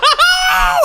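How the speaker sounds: shrieking laugh; real high-pitched cackle; high burst of laughter. Real, not acted.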